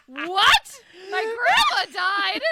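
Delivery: loud, high mock disbelief; shocked friend, retold. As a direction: act out a stunned outburst, drawn out. Acted shock at grandma's death.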